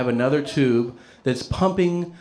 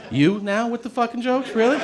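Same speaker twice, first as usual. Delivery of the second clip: high, incredulous retort with a laugh; mock exasperated; 11 semitones higher than usual. Answering the joking doctor in a high, exasperated voice. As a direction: throw it back in disbelief, pitched up.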